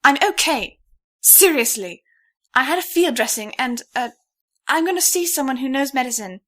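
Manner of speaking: lightly voiced heroine talking her way out; the stranger fends off the couple's help; flustered protest. A narrator's reading.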